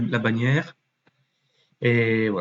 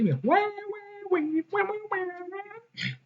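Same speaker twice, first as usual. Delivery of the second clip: yeses climbing into falsetto, fading softer; mock-agree, rising higher and higher; squeaked yeses trailing away; silly yeses; 7 dB quieter, slower than usual.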